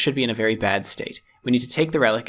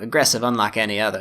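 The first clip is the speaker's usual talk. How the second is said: usual speaking voice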